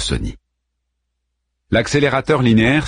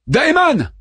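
calling out. A narrator's reading. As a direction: call his name from afar. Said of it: "appela Harman".

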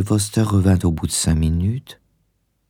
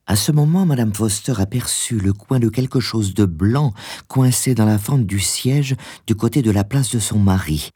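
usual speaking voice

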